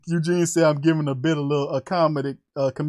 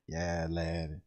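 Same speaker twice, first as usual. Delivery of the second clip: low, soft, laid-back; Lattimore; 8 semitones lower, 10 dB quieter, slower than usual. Acted. Doing Lattimore on a viewer's request, low and cool. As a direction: play it cool and unbothered, very low. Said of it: "Talk, talk Lattimore".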